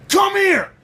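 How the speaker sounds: high, full-force imitated shout; explosive high-pitched cop's holler; screaming command. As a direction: yell it across the stands. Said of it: "He's screaming at me".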